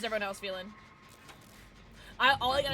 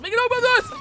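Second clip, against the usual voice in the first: "this" shouted much higher and louder as a plea; pleading shout; 14 semitones higher, 14 dB louder than usual. Real, not acted. Streamer pleading with the killer, high loud shout. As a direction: yell the line up high, begging. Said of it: "repeated louder".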